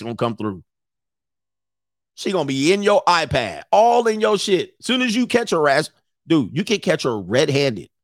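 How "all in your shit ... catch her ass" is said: ordinary talk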